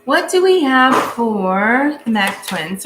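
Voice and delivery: slow, loud over the noise. Real, not acted. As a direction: call it out slowly and loudly. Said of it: "Sorry for the noise".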